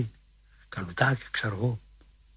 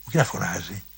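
ordinary delivery

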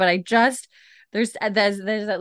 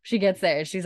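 ordinary talk, nothing marked

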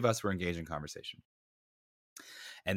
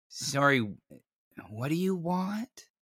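slow, unbothered deadpan question; drawn-out dry query; dry, flat; 6 semitones higher, slower than usual. Retold or imitated. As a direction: imitate someone totally unimpressed by a threat. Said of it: "So dry, so flat".

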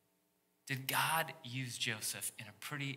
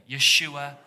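Yeshua called out loudly with the room; loud call-and-response Yeshua; congregation call; 12 dB louder than usual. Shown as a demonstration. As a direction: lead the crowd in saying Yeshua. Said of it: "Everybody say that together".

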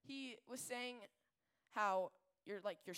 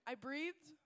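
ordinary talk, nothing marked

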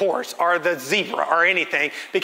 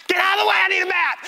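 high, fast comic yell; mock shout; 13 semitones higher, faster than usual. Dad elbowing parents away from the zoo map, shouting. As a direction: bark it like a frantic dad.

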